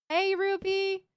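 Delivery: high, loud call across the room. Real, not acted. Calling out to Ruby to ask about the taco, loud and high. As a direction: shout her name to get attention.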